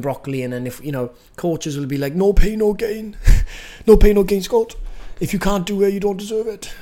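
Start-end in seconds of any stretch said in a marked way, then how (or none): 2.1-6.7 high-pitched mock hardline coach; weird coach voice. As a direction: put on a silly, high voice to parody a pushy coach. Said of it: "I don't know why I'm doing a weird voice".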